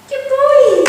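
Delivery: high, loud, gushing praise; excited dog praise. Real, not acted. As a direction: reward him with a big happy burst.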